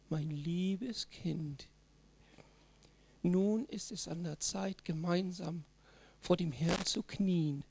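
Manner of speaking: slow, soft, overly sweet sanctimonious voice; syrupy churchy parent, drawn out; mock pious parent. (übertrieben salbungsvoller Elternton, langsam und leise)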